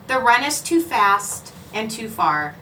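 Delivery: plain reading voice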